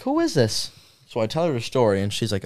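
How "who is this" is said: very high puzzled question; imitated mom. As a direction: squeak it out as a baffled mom.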